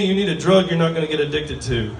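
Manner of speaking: ordinary talk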